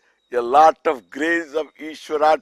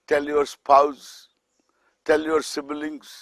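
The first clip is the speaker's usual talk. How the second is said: ordinary talk, nothing marked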